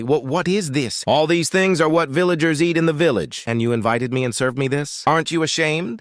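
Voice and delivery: high-pitched sarcastic indignation. A narrator's reading. Malika objecting to the village food, sarcastic. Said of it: "Malika says sarcastically".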